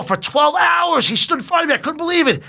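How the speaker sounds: nearly shouting how long the standoff lasted; father marveling at twelve hours of standing; incredulous outburst. Real, not acted. Retelling the twelve-hour standoff.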